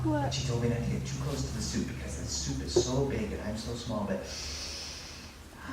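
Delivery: low, soft and quickly reasoning; quick, low private muttering; hushed self-talk. Acted. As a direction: talk yourself out of it under your breath. Mouse reasons with himself.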